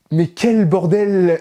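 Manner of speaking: « mais quel bordel! » lâché fort, lentement, exaspéré; exaspération. Real, not acted. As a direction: plus aigu et plus fort, on traîne chaque mot. Exaspéré par l'anglais. (s'exclamer, excédé par la prononciation anglaise)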